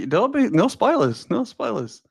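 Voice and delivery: high sing-song scolding, drawn out; playful warning. Real, not acted. Streamer stopping a viewer giving spoilers, playful warning. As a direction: warn them off in a high, slow lilt.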